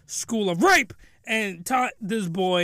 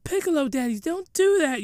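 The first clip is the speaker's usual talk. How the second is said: lightly voiced protesting woman; 6 semitones higher than usual. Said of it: "she's like".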